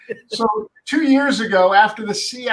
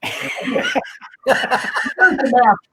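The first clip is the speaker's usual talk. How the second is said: laughing; 5 semitones higher, much slower than usual. Real, not acted. Laughing at his own story.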